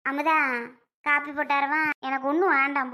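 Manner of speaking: ordinary delivery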